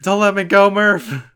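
hammed-up plea; loud, high, hammed-up pleading; anguished plea. Joining the Interstellar meme.

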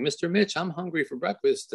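a hint of a little girl asking for breakfast; quoting his daughter. Little girl telling Mister Mitch she's hungry, lightly childlike.

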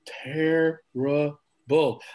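"terrible" stretched very slowly; drawn-out verdict. Real, not acted. Reviewer drawing out "terrible" to condemn the match. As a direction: drag out "terrible" in disgust.

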